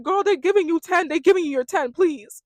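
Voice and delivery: loud, high exasperated retort; exasperated plea. Retold or imitated. Viewer talking back to the nervous contestant, exasperated.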